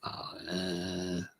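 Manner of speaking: ordinary delivery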